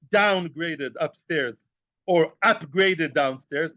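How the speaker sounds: "downgraded upstairs, or upgraded downstairs" drawn out slowly, each word weighed; slow emphatic. Shown as a demonstration. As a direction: spell out "downgraded" and "upgraded" slowly so they land. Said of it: "I mean what I say".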